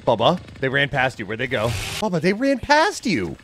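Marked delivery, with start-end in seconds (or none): 2.0-3.4 high, exasperated cry to a teammate. Real, not acted. Gamer to teammate who missed them, exasperated. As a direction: pitch leaps up in disbelief.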